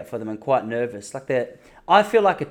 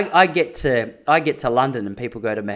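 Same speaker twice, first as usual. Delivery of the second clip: usual speaking voice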